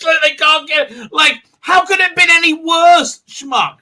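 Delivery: high, loud exasperated retort; mock indignant rant. Streamer ranting back at the president, loud and exasperated. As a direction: shout back at him in disbelief.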